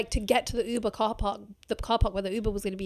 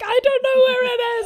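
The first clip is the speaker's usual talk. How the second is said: much higher and louder, frantic; panicked wail; 14 semitones higher, 9 dB louder than usual. Retold or imitated. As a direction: squeal it like a drunk panic. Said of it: "And I'm like".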